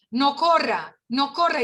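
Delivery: urgent parental order, acted; parent's warning. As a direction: call out to stop a running child. Parent calling out to child.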